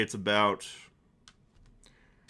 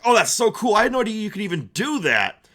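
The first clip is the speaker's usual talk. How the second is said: thrilled barkeep; 9 semitones higher, 6 dB louder, faster than usual. Acted. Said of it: "barkeep's like".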